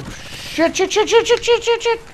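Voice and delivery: high, rapid panicked repeats; panicked cursing. Real, not acted. Gamer caught off guard, panicked high cursing. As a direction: blurt them in a rising panic.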